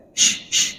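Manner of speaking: whispered shh to shoo chickens; unvoiced shooing shh; shooing hiss. Shown as a demonstration. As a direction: hiss shh like chasing hens away. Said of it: "ngusir ayam".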